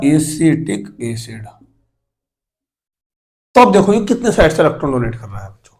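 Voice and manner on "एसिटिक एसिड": slowed repeat, एसिटिक एसिड drawn out; slow dictation. Real, not acted. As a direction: stretch एसिटिक एसिड syllable by syllable for note-takers. Repeats slowly for writing down. (एसिटिक एसिड को खींचकर, धीरे-धीरे दोहराते हुए)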